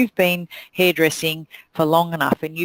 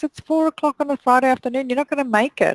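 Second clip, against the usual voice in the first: mock assistant; 8 semitones higher, faster than usual. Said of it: "she goes".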